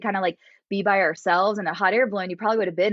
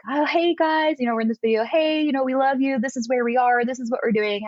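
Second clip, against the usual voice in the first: higher, upbeat imitation of a boyfriend; bubbly mock home-video hello; cheery video greeting; 6 semitones higher than usual. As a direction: greet the folks back home on camera. Imitating fiance greeting family on video.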